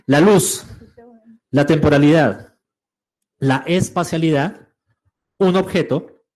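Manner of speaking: loud, spaced-out items; loud listing. Real, not acted. Teacher listing the dimensions a student asked for, loud and emphatic. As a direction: call out each item to the whole room. Calls out requested list to class.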